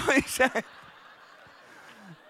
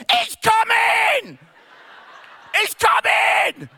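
loud, much higher, slow theatrical cry; dramatic wail; 11 semitones higher, 10 dB louder, much slower than usual. Retold or imitated. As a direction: throw the head back and wail it. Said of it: "tips her head back and she just goes".

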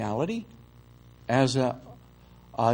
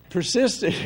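laughing; 7 semitones higher than usual. Real, not acted. Laughs mid-word.

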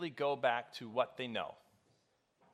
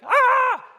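very high, loud shriek; girlish shriek; 16 dB louder than usual. Retold or imitated. Preacher reenacting his elephant fright with shriek. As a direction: shriek like a little girl. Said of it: "it was that high pitch".

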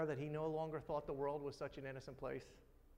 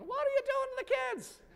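much higher, loud, alarmed mom voice; alarmed mother; 7 dB louder than usual. Retold or imitated. Mother shouting at dad wrestling the kids, high alarm. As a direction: squeal it like a worried mother. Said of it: "my mother would be going".

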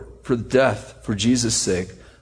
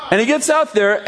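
ordinary delivery

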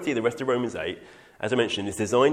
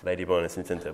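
normal talking voice

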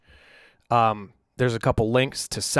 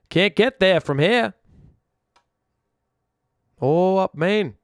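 put-on weak Maine accent; 7 semitones higher, 4 dB louder, slower than usual. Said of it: "so he can do the Maine accent, 'cause I can't do it well".

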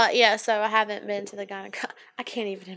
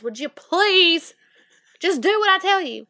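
exasperated demand; 6 semitones higher, 4 dB louder than usual. Retold or imitated. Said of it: "And I was like".

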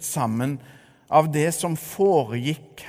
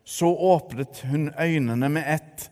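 ordinary talk, nothing marked

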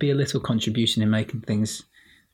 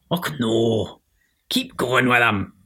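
put-on light Scottish accent; a hint of Scots encouragement; 3 dB louder, slower than usual. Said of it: "Thanks, Scottish [name]".

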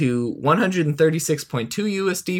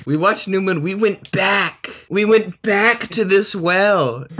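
mock dramatic; 8 semitones higher, 4 dB louder than usual.

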